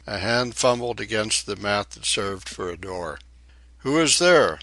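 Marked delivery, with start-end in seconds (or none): 3.8-4.6 raised, higher-pitched challenge; narrator's raised-voice cry. A narrator's reading. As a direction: call out a startled question, louder and higher. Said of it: "Mapui cried".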